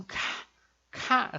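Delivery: "K" with air rushing out; breathy puff on "K"; aspirated K. Shown as a demonstration. Teacher showing the air on the aspirated K. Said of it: "esse ar".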